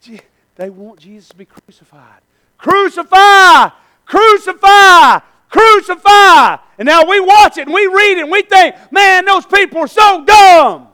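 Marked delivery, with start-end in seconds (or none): 2.6-6.6 high, loud, drawn-out chant shout; slow repeated mob cry; screaming mob. Acted. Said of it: "they're screaming out against". 6.8-10.9 high, loud sermon shout; raised, forceful pulpit delivery; full-force preaching. Real, not acted.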